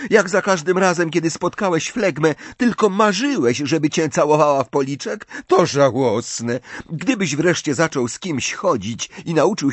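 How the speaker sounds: high-pitched, scornful tirade; heated taunting. A narrator's reading. Ginny mocks Ron's lack of kissing, heated.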